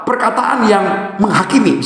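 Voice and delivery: usual speaking voice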